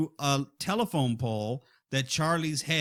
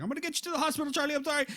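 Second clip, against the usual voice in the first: panicked; 12 semitones higher, much faster than usual. Retold or imitated. Brother panicking, driving to hospital.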